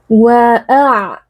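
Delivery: "Wa'a" chanted with the ain voiced and drawn on; flowing ain. Shown as a demonstration. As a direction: let the throat sound in "Wa'a" keep flowing. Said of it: "harus ada aliran suaranya".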